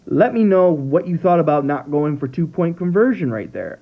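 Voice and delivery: normal talking voice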